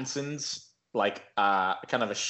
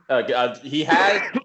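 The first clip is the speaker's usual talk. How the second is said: ordinary delivery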